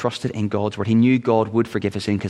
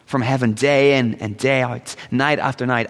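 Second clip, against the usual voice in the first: ordinary talk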